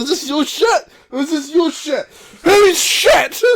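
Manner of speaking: loud, high, laughing scold building to a shout; mock interrogation. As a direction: shout the question at the dogs. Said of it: "with the shit going".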